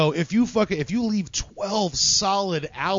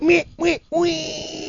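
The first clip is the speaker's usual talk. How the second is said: silly clown; 10 semitones higher, slower than usual. Pretend. Goofy bleating noise.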